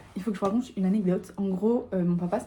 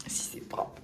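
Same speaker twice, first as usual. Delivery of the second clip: whispering; 12 dB quieter, much slower than usual. Real, not acted. Checking the hotel room quietly.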